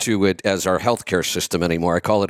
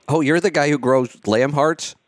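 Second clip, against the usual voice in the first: mimicking customer; 8 semitones higher than usual. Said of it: "the way he said it".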